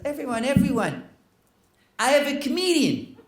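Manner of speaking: high, wavering call for attention; elderly hostess announcing. Retold or imitated. Seventy-five-year-old hostess to dinner guests, high-pitched announcement. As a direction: pipe up to hush the room.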